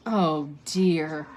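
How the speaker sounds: understated, sorry sigh of words; a touch of regret; sad. A narrator's reading. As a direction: say it with mild sadness. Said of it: "said Hermione sadly".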